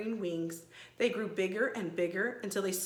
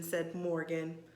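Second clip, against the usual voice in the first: usual speaking voice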